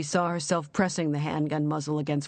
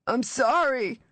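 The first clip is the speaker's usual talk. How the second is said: raw, terrified; 7 semitones higher, slower than usual. Acted. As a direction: beg forgiveness in raw panic. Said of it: "raw, terrified voice".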